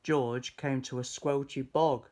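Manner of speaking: usual speaking voice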